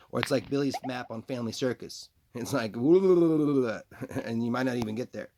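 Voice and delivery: a hammed-up alter voice nagging Paul; different voice. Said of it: "We're saying it in a different voice now".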